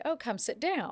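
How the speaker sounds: gracious host. Acted. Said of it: "where you would say".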